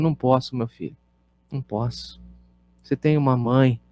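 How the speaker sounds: a quiet fatherly no, said softly; tender refusal. Retold or imitated. Pastor tenderly telling the fatherless boy he cannot take him.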